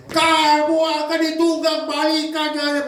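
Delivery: high, slow angry proclamation; angry warning. Acted. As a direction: scold the city of doom. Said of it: "marah-marah".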